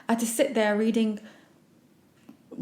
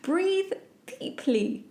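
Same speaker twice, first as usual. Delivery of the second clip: much higher, sing-song mock of the advice; sarcastic parroting; mocking quote; 9 semitones higher, much slower than usual. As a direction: ridicule the pharmacist's advice. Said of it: "most silliest comments".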